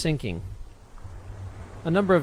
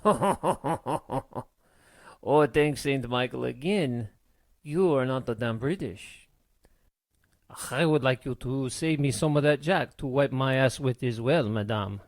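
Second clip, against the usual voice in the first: put-on French-accented sailor, amused and thankful. A narrator's reading. Frenchman thanks the captain for not being British, French accent. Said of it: "The Frenchman laughed".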